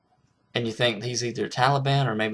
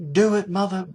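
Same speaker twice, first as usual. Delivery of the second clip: higher-pitched defiant curse; cursing dare; 8 semitones higher than usual. Retold or imitated. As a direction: taunt the captors to go ahead. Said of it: "he's like".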